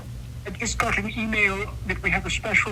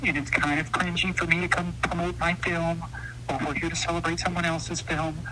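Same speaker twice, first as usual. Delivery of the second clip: normal talking voice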